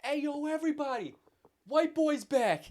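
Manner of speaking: Corey shouting outside to everybody; announcing shout. Retold or imitated. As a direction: announce it to the whole street. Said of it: "stands tall and just is like".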